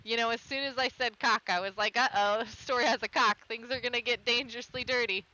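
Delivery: loud, giggly confession; amused aside. Real, not acted. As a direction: let it spill out playfully. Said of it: "loud amused aside to viewers".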